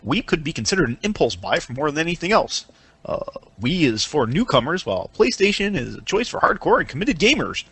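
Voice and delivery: hushed mock-quote reading voice; Cracker voice. Podcaster reading a Sony quote, soft Cracker voice. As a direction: read it softly as a put-on columnist. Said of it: "I'm gonna use my Cracker voice".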